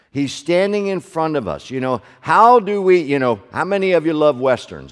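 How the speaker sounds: loud, ringing preaching to the congregation; preaching at full force. Acted. Pastor preaching to his congregation, loud and ringing. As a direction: proclaim it out over the room.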